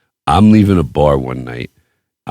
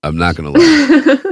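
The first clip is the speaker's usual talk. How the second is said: laughing it out, voice jumping much higher. Real, not acted. Admitting to the co-host he loves fighting, laughing. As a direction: breaks into a real laugh on the repeat. Repeats line, second take laughing.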